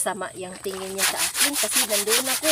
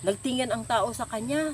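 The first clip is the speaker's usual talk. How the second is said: ordinary delivery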